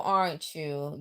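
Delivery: usual speaking voice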